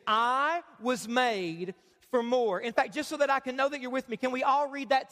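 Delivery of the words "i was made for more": each word slow and weighted; slow emphatic statement. Shown as a demonstration. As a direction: read it slowly, like a motto to remember. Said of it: "There it is".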